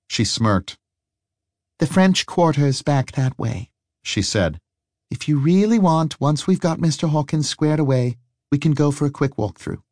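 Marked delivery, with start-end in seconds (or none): 1.8-3.7 lightly voiced wry woman; a hint of a teasing woman; smirking woman. A narrator's reading. 5.1-9.8 lightly voiced wry woman; a hint of a teasing woman; smirking woman. A narrator's reading. Harry voiced.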